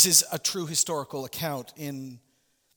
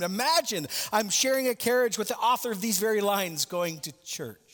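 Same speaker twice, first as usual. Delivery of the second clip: excited; 6 semitones higher than usual. Acted. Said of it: "she exclaimed".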